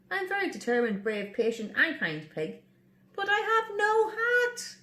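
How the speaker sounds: high, content pig voice; proud hatless pig. Acted. Pig listing virtues without his hat, high. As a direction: end on a bright high note.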